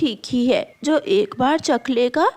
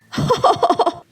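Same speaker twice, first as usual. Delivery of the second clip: mocking laugh; 8 semitones higher, 4 dB louder than usual. Crow taunts the girl.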